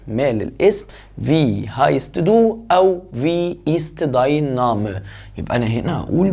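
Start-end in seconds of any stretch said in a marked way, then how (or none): 1.2-5.0 slow dictation. Shown as a demonstration. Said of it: "slow model of the question".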